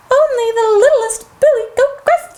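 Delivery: high, small billy goat voice; littlest goat. Acted. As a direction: pipe up as a tiny goat. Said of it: "said the little goat".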